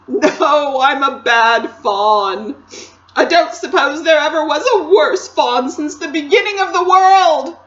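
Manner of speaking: loud self-blaming wail; between sobs. A narrator's reading. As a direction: wail it out miserably, rising to the end. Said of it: "replied Mister Tumnus between his sobs".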